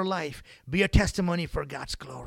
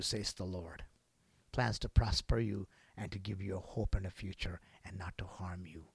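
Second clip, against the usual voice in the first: soft whispered promise; 9 semitones lower, 10 dB quieter than usual. Acted. Preacher quoting Jeremiah to congregation, whispering. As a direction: whisper it like a secret.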